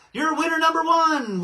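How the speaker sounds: high, loud winner announcement; game-show announcer. Pretend.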